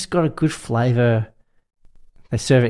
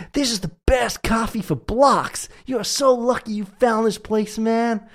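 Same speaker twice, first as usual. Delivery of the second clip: put-on high-pitched New York accent, the first words slow; 12 semitones higher, slower than usual. A New Yorker praising his coffee shop to a tourist, in a New York accent. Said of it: "I can't even do the New York accent".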